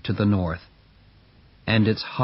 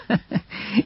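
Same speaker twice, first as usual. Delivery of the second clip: mocking laugh; 9 semitones higher than usual. Men laughing at the sledge.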